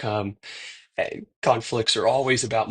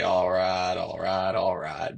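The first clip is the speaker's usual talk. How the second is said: Matthew McConaughey drawl; 2 semitones lower, slower than usual. Retold or imitated. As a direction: ease into a mild Texan "all right, all right, all right". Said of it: "call it the Matthew McConaughey voice".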